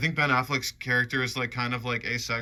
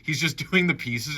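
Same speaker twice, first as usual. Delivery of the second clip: ordinary talk, nothing marked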